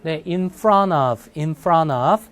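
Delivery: in front of, slowly linked with the T dropped: "in fran of"; linked pronunciation. Shown as a demonstration. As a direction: run in front of together as "infranov". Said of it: "연결해서 읽으면 발음 어떻게 간다고요?".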